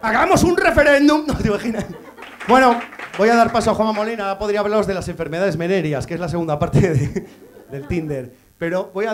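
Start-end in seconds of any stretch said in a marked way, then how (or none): none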